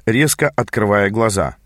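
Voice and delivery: ordinary delivery, nothing marked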